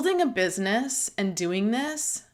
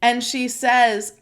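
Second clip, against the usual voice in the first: ordinary talk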